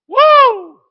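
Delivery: big, high, joyful shout; ecstatic Pentecostal whoop. Real, not acted. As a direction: let out a Pentecostal cry of praise. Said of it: "I'm gonna go Pentecostal on you".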